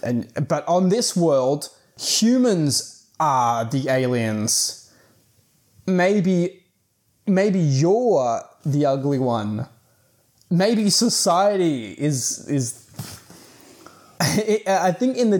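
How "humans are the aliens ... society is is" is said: slow, drawn-out dramatic pronouncement; mock profound. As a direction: stretch each phrase as a grand revelation. Mock-profound moral of the twist.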